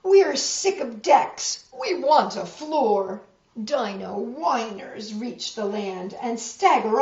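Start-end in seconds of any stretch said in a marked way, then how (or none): 0.0-3.2 loud, higher whiny protest; loud whining outcry; fed-up cry. A narrator's reading. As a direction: complain loudly like sick sailors. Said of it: "they cry".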